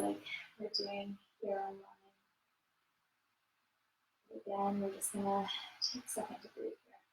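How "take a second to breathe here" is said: hushed, calm yoga cue; soft spa whisper. Acted. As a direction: lull them into rest. Guides breathing in cool-down.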